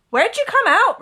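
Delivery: high, loud eager question; Ron asking. Acted. Ron asking Harry where he landed, eager. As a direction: blurt it out curious. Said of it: "Ron asked".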